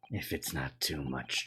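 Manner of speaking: weary whispered request. Acted. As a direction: let the words die away to a whisper. Sholmes fading into gloom.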